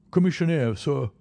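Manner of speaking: clipped gruff soldier reply. A narrator's reading. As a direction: answer like a gruff old sergeant. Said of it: "he said gruffly".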